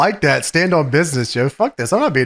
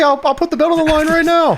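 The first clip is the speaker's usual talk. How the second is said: mock eager challenge; 13 semitones higher than usual. Acting Angelina's hasty challenge.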